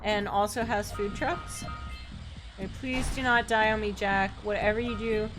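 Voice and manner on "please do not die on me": ordinary delivery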